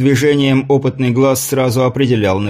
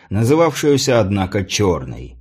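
usual speaking voice

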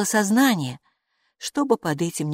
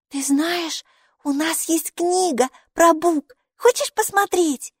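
put-on high, bright child's voice, chattering; a cheerful little girl greeting her strange friend; little girl Maiki; 11 semitones higher, slower than usual. Майки, маленькая девочка, радостно болтает с Букой.

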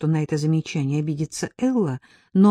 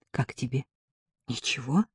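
tentative asking; 3 semitones lower, 6 dB quieter than usual. A narrator's reading. Shows pin to her friend, asking.

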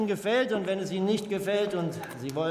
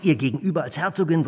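normal talking voice

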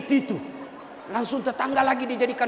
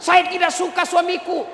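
protesting wife; 6 semitones higher, 4 dB louder than usual. Acted.

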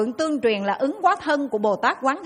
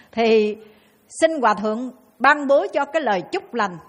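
normal talking voice

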